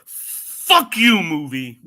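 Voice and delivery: exasperated, punched-up swearing; exasperated outburst. Real, not acted. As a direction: hurl it at the film, loud then settling. Cursing at the hated movie.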